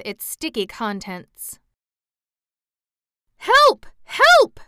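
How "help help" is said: very high, loud panicked scream. A narrator's reading. Egg-struck king screaming for help, panicked. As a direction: shriek for help. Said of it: "screamed the king".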